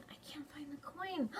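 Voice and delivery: hushed, anxious whisper; worried whisper. Acted. Child realising the coin is lost, whispered. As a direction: whisper it as dread sinks in.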